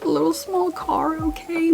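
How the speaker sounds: small high pleading voice; cutesy high voice. Real, not acted. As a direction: squeak it out sweetly.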